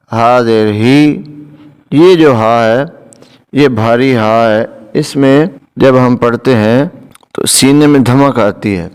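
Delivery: usual speaking voice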